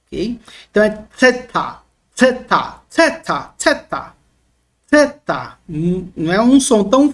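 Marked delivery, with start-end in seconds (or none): 1.2-5.6 theta repeated, tongue between teeth, blowing the TH; blown dental TH. Shown as a demonstration. Teacher drilling a sound hard to reproduce, blown TH. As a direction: aspirate the TH in each theta.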